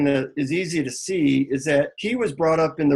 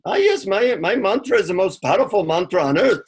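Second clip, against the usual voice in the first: put-on higher-pitched Indian guru accent; 5 semitones higher, 3 dB louder than usual. Guru proudly presents his mantra to a seeker, imitated Indian accent. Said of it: "in an Indian accent".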